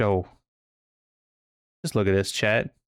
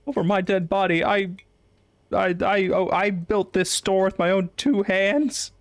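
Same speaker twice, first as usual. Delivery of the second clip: lightly raised voice of an offended shop owner; indignant shopkeeper; 8 semitones higher than usual. Acted. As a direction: play the outraged store owner. Character voice for store owner.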